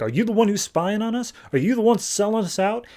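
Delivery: hammed-up angry father confronting a spy; mock accusation. Angry dad accuses spying Hal.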